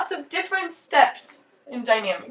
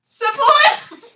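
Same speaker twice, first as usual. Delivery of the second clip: bright shouted answer, high and loud; loud call; 10 semitones higher, 5 dB louder than usual. Shown as a demonstration. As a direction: call out the word support. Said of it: "what's the S word?".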